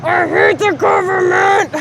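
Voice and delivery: high, drawn-out yell of frustration; mad shout. Real, not acted. Raging at noises interrupting the video, mad shout. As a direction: shout it high and slow, on the edge of laughter. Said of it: "I got mad because of the".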